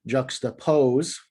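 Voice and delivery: slow juxtapose, stress on -pose; anapest stress. Shown as a demonstration. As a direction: draw out juxtapose, weight on the last syllable. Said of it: "being a few examples of words that in and of themselves are anapests".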